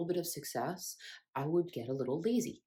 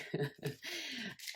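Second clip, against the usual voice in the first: high laugh; 9 semitones higher, 6 dB quieter than usual. Real, not acted. Laugh at own joke.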